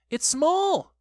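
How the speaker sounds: high, loud squeal of discovery; thrilled little voice; excited cry. A narrator's reading. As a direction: squeal the news. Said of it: "cried Piglet".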